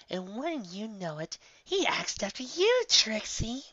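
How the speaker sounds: high, slow teasing lilt; sing-song tease. Acted. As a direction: draw it out to needle her. Bon Bon teasing Trixie.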